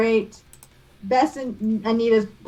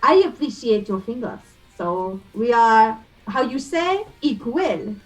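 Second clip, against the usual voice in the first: put-on French accent. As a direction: grope for the word in the French lilt. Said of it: "I'm killing this accent".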